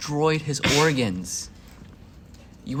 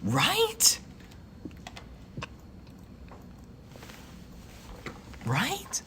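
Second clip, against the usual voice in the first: squeaky high right; 11 semitones higher, 6 dB quieter, much slower than usual. Real, not acted. Seeking agreement, pitch leaps.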